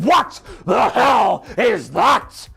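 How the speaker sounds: hammed-up angry boss shout; mock shout. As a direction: bellow it at the screen. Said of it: "and shouting".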